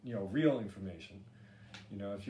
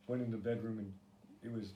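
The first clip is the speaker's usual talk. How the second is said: ordinary talk, nothing marked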